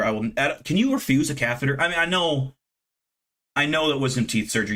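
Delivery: ordinary delivery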